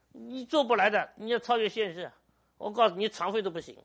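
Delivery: preaching loud. Real, not acted. Pastor exhorting the congregation.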